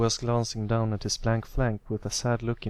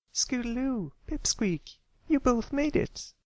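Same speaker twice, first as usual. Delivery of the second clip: very high, happy filly voice; excited filly greeting; slower than usual. Acted. A filly greeting her arriving friends, very high and happy.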